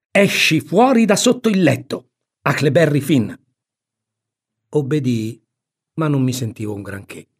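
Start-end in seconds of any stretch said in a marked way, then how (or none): 0.1-3.3 higher, louder scolding order; commanding aunt. Acted. Aunt orders Huck from under the bed, commanding. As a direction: order the boy out sternly and loudly.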